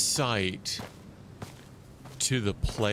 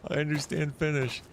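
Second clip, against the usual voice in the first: mock surprised reply; 6 semitones higher than usual. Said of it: "And I'm like".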